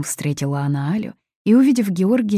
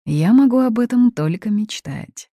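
raised, lilting irony; ironic; 8 semitones higher, 6 dB louder than usual. A narrator's reading. Son replies about her staying in Greece, ironic. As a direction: answer with a touch of irony. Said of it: "тихо и, как всегда, иронично ответил Крис".

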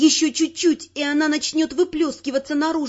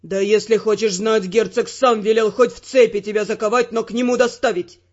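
low male voice, blurting it out; blurted by Ferrant; 5 semitones lower, 3 dB louder than usual. Acted. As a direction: let it slip in anger. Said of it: "выпалил он".

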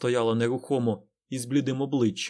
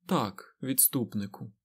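quiet, icy reply; cold voice; 4 dB quieter, slower than usual. A narrator's reading. Aes Sedai answers the Forsaken, coldly. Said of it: "промовила Морейн голосом холодним".

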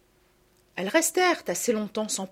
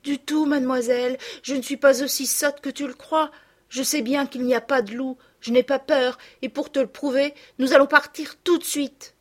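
put-on higher, emphatic child's retort; indignant girl; 6 semitones higher, 4 dB louder than usual. A narrator's reading. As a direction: insist you are not scared. Girl indignantly defends her courage. (fillette vexée qui se défend)

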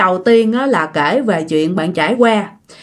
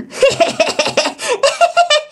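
high-pitched mocking cackle; put-on teasing laugh; imitated giggle. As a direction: cackle high in quick bursts, imitating an unseen prankster. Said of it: "nó còn cười nữa".

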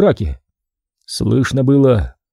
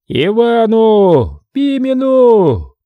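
high, long-drawn chant of a name; rallying shout; 9 semitones higher, 4 dB louder, much slower than usual. A narrator's reading. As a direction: call the name out over a brawl. Rival factions shout out candidates' names.

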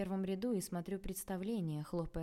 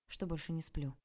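ordinary delivery, nothing marked

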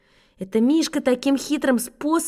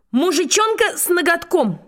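slow, high-pitched proud recital; proud declamation; 6 semitones higher than usual. Acted. Schoolboy repeating the whole wrong title to the class, proudly declaimed. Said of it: "И повторил всё вместе".

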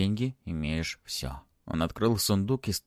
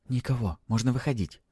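plain reading voice

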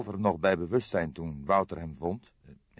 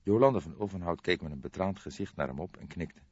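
plain reading voice

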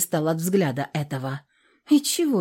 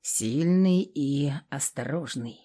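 slow and softer, pensive; slowly; 4 semitones lower, 5 dB quieter, slower than usual. A narrator's reading. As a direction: let it sink in slowly. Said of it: "медленно заговорил Январь".

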